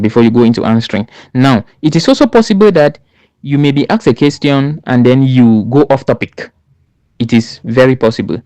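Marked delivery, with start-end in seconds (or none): none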